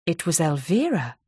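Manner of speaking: ordinary delivery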